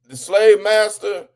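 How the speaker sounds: usual speaking voice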